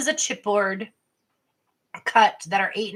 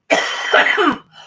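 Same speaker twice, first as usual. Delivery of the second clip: harsh airless cough, pretzel stuck in the throat; real choking cough; 8 semitones higher, 8 dB louder than usual. Real, not acted. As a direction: cough hard to clear the throat. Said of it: "piece of pretzel that got stuck".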